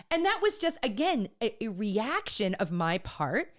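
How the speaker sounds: ordinary talk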